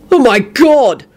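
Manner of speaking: high, appalled outburst; bellow. A narrator's reading. Host bellowing at drunken singer over his song. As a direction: bellow in outraged disbelief. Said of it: "Quentin bellowed".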